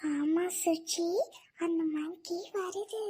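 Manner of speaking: put-on children hiding from a monkey, whispering softly; hushed. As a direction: keep it down so the monkey won't hear.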